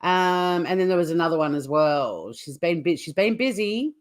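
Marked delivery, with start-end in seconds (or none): none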